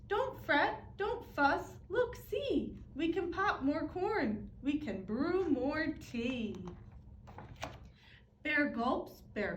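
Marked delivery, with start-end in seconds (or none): 0.1-6.6 high, soothing little mouse squeak; squeaky mouse. Acted. Mouse calming the crying bear, squeaky. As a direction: comfort the bear in a tiny voice. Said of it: "Mouse squeaks".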